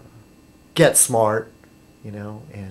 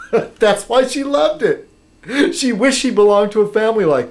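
laughing; 8 semitones higher than usual. Real, not acted. Laughing at ex's odd view.

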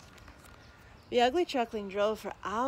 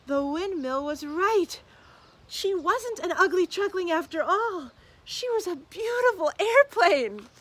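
louder, higher triumphant narration; joyful reveal; 9 semitones higher, 10 dB louder than usual. A narrator's reading. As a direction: build to a triumphant big reveal. Joyful reveal at story climax.

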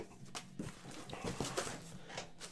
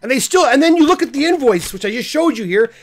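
angry rant; 6 semitones higher than usual. Real, not acted. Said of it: "I'm just very angry about this now".

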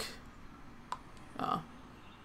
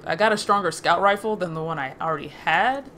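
ordinary talk, nothing marked

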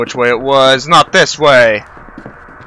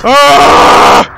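high, loud scream of fright; long sudden shriek; startled scream; 10 semitones higher, 8 dB louder than usual. Real, not acted. Player jumped in the dark, startled scream. Jump scare in game.

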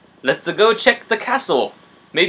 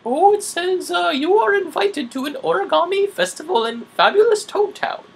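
much higher, lightly cartoonish Mario; bad Mario impression; 12 semitones higher than usual. Mario reading the invitation to Luigi, high cartoon voice. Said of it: "really bad Mario impression".

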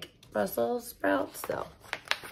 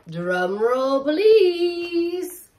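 rising, excited build to a high please; drum roll please; 7 semitones higher, much slower than usual. Acted. Reader asks kids for a drum roll, excited. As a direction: tease the big reveal.

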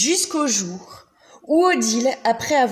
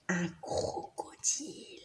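slow, hushed whisper; 4 semitones lower, 15 dB quieter, slower than usual. A narrator's reading. Revealing the crocodile to the children, slow whisper. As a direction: drop to a secret whisper for suspense.

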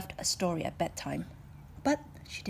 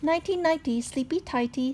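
high, louder lilting goodnight; maid's sing-song goodnight; 7 semitones higher, 7 dB louder than usual. Acted. Maid says goodnight, lilting and high. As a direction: tuck her in with a cheery rhyme.

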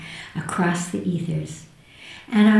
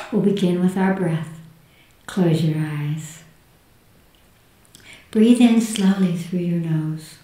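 hushed and soothing, guiding inward. Acted. Leads a guided meditation.

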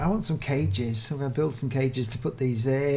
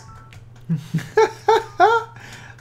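high, loud giggle of delight; delighted laugh; 5 dB louder than usual. Real, not acted. YouTuber seeing a tiny rabbit kit, high delighted laugh. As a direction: burst out laughing at the cute kit.

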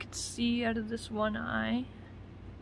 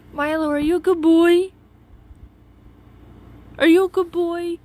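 talking to a pet; 7 semitones higher, 12 dB louder than usual. Real, not acted. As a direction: coo at the cat, high and loud. Baby talk to the cat.

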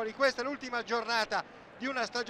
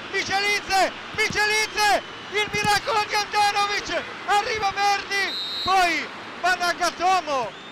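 higher, louder rapid-fire calls; breathless italian goal commentary; 6 semitones higher, 5 dB louder than usual. Real, not acted. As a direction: chase every touch out loud. Frantic attack in commentary.

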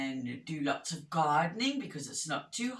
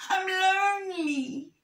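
hammed-up forlorn little bear; lonely moan; 5 dB louder than usual. A narrator's reading. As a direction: moan pitifully up high. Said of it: "he moaned".

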